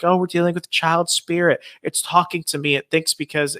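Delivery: mocking ghost hunter. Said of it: "ghost hunters ... who are like".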